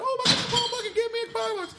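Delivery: very high-pitched mock-excited squeal; hammed-up falsetto excitement; gushing fan. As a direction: imitate a starstruck fan, way up high. Acting out the thrilled fan's reaction.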